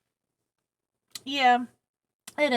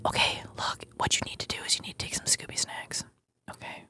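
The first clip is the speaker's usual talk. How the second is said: hushed, low-pitched close-mic whisper; ASMR whisper; 5 dB quieter than usual. Pretend. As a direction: give earnest mock instructions in a whisper. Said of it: "Am I whispering too loudly".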